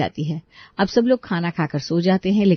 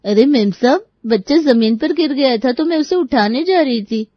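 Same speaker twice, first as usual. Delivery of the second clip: high-pitched, raised servant voice; protesting maid; 7 semitones higher, 4 dB louder than usual. Acted. Maid explains she was lifting the fallen child, protesting. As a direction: plead your case to the mistress.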